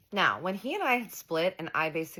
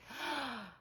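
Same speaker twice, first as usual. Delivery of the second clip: high, soft intake of breath; mock-shocked gasp; offended gasp; 11 semitones higher, 7 dB quieter than usual. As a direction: gasp as if affronted. Retold shocked reaction in quarrel.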